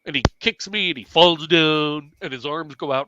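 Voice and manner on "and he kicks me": ordinary delivery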